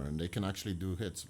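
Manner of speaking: hushed secret aside; conspiratorial whisper. Real, not acted. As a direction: drop to a whisper as if overheard. Secret hitman talk whispered.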